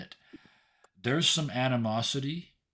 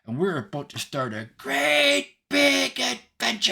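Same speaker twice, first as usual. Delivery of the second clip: put-on high, loud, insolently cheerful tiny girl; excited high-pitched little creature, building to a loud finish; tinkling little Hope; 13 semitones higher, 8 dB louder than usual. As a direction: announce it big in a tiny high voice. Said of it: "her voice tinkles".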